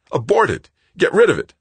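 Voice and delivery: high, loud frantic shout. Acted. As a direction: yell it in panic. Greg yelling at Joanna.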